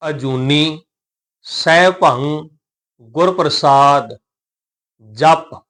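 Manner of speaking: slow, measured phrase-by-phrase recitation; scripture recitation. Shown as a demonstration. Teacher models the opening verse for learners, measured recitation. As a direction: recite each phrase slowly, with clear pauses.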